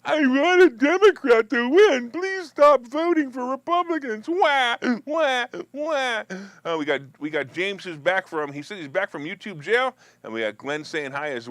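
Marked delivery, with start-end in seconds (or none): 0.0-6.2 loud, high, drawn-out mock wailing; mock a whiny ranter, wailing slowly at full volume; Ken ranting and raving. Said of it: "We got Ken ranting and raving".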